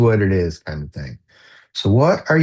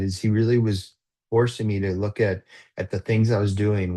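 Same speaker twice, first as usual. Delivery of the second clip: usual speaking voice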